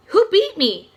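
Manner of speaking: high, loud stunned question; can't believe he lost; amazed. A narrator's reading. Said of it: "Connor seemed amazed".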